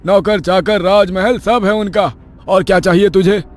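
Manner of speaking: high-pitched, angry scolding; angry husband. Acted. Husband scolding his worried wife over omens, angrily. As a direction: berate her with exasperated anger. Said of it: "पति की गुस्से से भरी आवाज".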